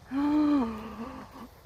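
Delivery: long, awed intake of breath; real gasp of wonder; amazed gasp. Real, not acted. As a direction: gasp at something beautiful. Amazed at a beautiful horse.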